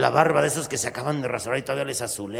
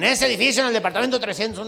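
loud pointing out; 7 semitones higher, 7 dB louder, faster than usual. Acted. Compadre points out the apartment.

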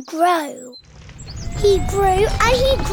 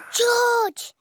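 usual speaking voice

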